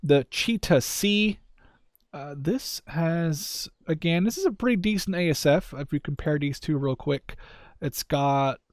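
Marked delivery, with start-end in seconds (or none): none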